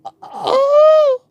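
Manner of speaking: very high, loud oh oh; panicked high exclamation; shocked gasp. Retold or imitated. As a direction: squeal in alarm.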